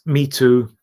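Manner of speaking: ordinary delivery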